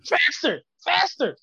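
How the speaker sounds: high, loud ship orders; excited shouting. Pretend. Joking ship commands.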